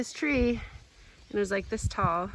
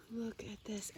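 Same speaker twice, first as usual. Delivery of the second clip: whisper; 9 dB quieter than usual. Real, not acted. Showing ripe raspberries.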